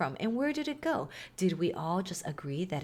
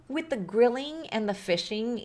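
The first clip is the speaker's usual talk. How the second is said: ordinary delivery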